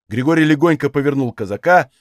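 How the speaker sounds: ordinary delivery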